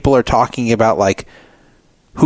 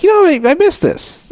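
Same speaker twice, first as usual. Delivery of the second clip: high surprised. Retold or imitated. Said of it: "I'm like".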